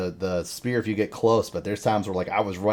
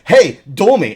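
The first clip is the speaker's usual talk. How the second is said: higher, louder cocky mock challenge; 8 semitones higher, 10 dB louder, slower than usual. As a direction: throw down the challenge like a cocky rival. Imitating J J challenging.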